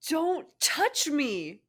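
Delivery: very high, loud and slow cry; cried out. Acted. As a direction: hurl it at him, drawn out. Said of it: "she cried".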